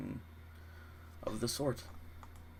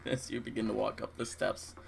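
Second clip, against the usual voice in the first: usual speaking voice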